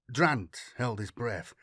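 ordinary delivery, nothing marked

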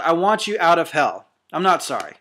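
ordinary talk, nothing marked

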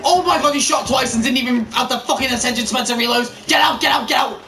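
panicked shouting. Real, not acted. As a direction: yell at the screen in panic. Frantic escape in the game.

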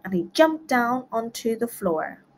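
ordinary delivery, nothing marked

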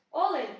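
Олень stressed on the opening О; stress on first syllable. Shown as a demonstration. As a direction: lean on the first vowel of Олень. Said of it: "наголос на першому складі". (наголос на першому складі: «Олень»)

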